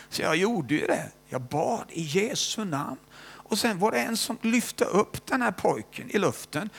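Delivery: usual speaking voice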